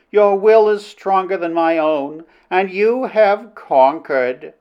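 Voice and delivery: high-pitched princess, slowing at the end; conquered princess. Acted. Princess admits Flynn's will is stronger, conquered. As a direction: concede solemnly as the princess.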